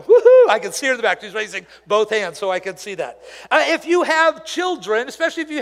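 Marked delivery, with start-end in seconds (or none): 0.0-0.5 high, loud imitated whoop of joy; wife's cheer. Pastor imitating his wife cheering from the back, a loud whoop. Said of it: "My wife is back there going".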